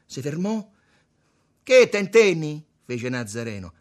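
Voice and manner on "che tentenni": high, slow, very loud taunt; shouted goad. A narrator's reading. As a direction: prod him at full voice. Nazareno incalza Tommaso. (incitamento gridato)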